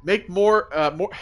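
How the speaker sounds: winded, short of breath; reading while catching breath. Real, not acted. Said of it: "I'm a little out of breath".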